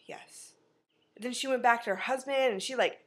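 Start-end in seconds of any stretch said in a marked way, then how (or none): 0.1-0.5 whispered yes. Retold or imitated. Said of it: "she's like".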